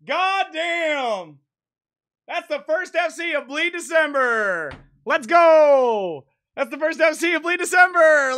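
high, loud victory cheer; whooping celebration after finally nailing it; triumphant shouting. Real, not acted. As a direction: yell it out in real triumph, stretching the last words. Celebrating a long-sought full combo.

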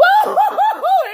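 high, loud shrieking laugh; whooping laugh. Real, not acted. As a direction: let out a big hooting laugh. Whooping laugh at stinky dog.

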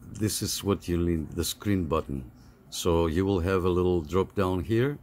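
ordinary talk

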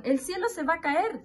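high-pitched alarm, only lightly projected; mock shouting. A narrator's reading. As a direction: keep up the pretend outcry. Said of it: "Seguían gritando". (grito de cuento, agudo y moderado)

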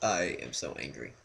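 low, quiet deadpan claim of anger; badly faked anger, soft and low; unconvincingly angry. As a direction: claim fury without sounding it at all. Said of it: "You don't even sound angry!".

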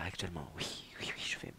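drowsy whisper; sleepy whisper. Real, not acted. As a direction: whisper it as if half asleep. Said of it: "mon lit me fait rêver".

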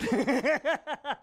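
real laugh, breathy and high; high airy laugh. Real, not acted. Father laughs about writing it down, high breathy giggle. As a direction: let a short laugh burst out. Laughing at his own joke.

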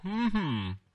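high, falling royal hum; storybook king's hemming; pondering king. A narrator's reading. King responds, somewhat put out.

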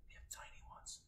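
hushed whisper. A narrator's reading. Teacher whispering to kids about tiny tongues. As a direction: whisper it, almost a secret.

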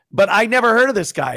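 usual speaking voice

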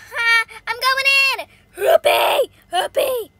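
loud, high charging whoops; gleeful battle cry. Pretend. Charging into the fight.

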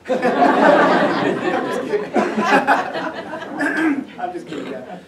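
breaking into laughter mid-joke; laughing. Real, not acted. Speaker laughing at his own joke about his dad. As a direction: laugh the words out, half-breathless. Joking about dad's stink eye.

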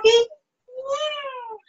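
high little puppet agreement; puppet Crookie. Acted. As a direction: squeal a happy yes as the puppet.

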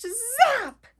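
high, loud buzzing zap; lightning zap. Acted. Imitating lightning.

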